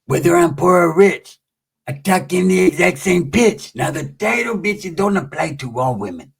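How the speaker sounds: halting, put-on homeboy voice; Pepe. Pepe advising his friend about Changa, put-on impression. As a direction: imitate him, stuttering into a rhyme. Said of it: "He's like".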